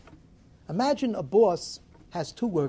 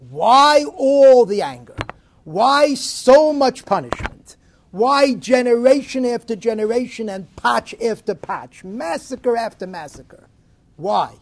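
louder, slow, pleading rhetorical questions; impassioned cry; 4 semitones higher, 12 dB louder, slower than usual. Acted. Preacher crying out to God.

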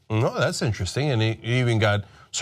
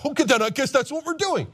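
dim mock president; 10 semitones higher than usual. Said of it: "and he's like".

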